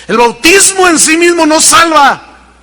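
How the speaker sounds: loud, high preaching insistence; preaching shout. Real, not acted. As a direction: hammer it home from the pulpit. Preacher insists against works.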